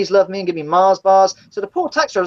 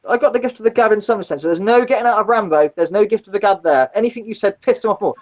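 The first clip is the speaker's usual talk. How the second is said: ordinary talk, nothing marked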